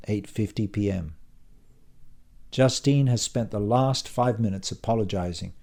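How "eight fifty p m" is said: usual speaking voice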